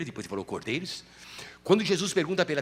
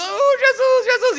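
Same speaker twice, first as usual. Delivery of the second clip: much higher, loud joyful cry; excited call; 8 dB louder, slower than usual. Acted. Peter crying out as Jesus appears, excited. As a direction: call out to him in longing.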